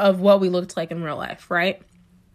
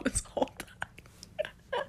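whispered in retold disbelief; whispered gasp of realization; shocked whisper; 13 semitones higher, 19 dB quieter, slower than usual. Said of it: "I was like".

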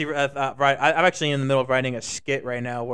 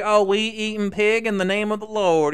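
lightly mocking churchgoer, higher pitch; Southern Baptist churchgoer; 5 semitones higher, 4 dB louder than usual. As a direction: pitch it up as a pious barbecue eater. Mimics Southern Baptists at barbecue.

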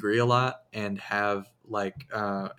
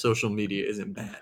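ordinary delivery

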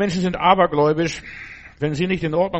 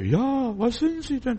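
pitched way up, wide-eyed and credulous; awed believer; 10 semitones higher, slower than usual. Retold or imitated. A believer voiced in awe of the magician, much higher. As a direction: gasp it out like someone dazzled by a magician.

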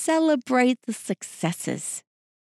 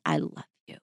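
whispered; 8 dB quieter, faster than usual. Shown as a demonstration. Don't just say it.

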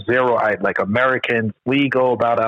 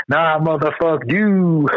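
higher, slow, drawn-out defiance; defiant retort; 6 semitones higher, much slower than usual. Retold or imitated. Host voicing man defying soldiers, slow pitched-up contempt. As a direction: stretch it out, pitched up, full of contempt.

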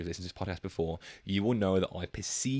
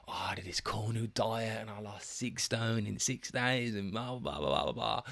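mocking whisper; 2 semitones higher, 5 dB quieter than usual. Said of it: "they're like".

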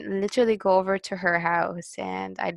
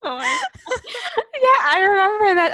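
high, loud, through real laughter; laughing; 13 semitones higher, 5 dB louder, slower than usual. Real, not acted. Friend remembering the outburst, really laughing. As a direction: barely get it out for laughing. Cracking up at the memory.

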